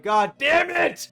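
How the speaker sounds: high, loud, slow mock groan of annoyance; frustrated guard groan. Security guard dreading the stage invite, slow exaggerated high cry. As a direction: drag it out like a fed-up bouncer.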